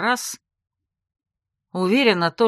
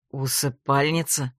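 nervous; 5 semitones lower than usual. A narrator's reading. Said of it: "нервно переспросил Грег".

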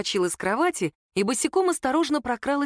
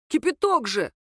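higher-pitched, mildly raised protest; angry yell; 5 semitones higher than usual. A narrator's reading. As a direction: exclaim indignantly at being scalded. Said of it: "заорал он".

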